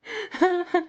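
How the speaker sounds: high, breaking laugh. Real, not acted. Reader laughing after the royalty line. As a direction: burst into high-pitched laughter.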